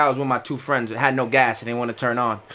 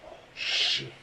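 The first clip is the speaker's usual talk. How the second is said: whispered oh shit; 10 dB quieter than usual. Retold or imitated. Friends blame him, whispered dismay. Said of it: "I was like".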